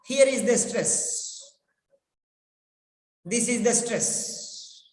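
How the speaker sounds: ordinary delivery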